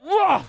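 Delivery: high, loud groan of disgust. Real, not acted. Host shuddering at the thought of nailing himself, loud disgusted groan.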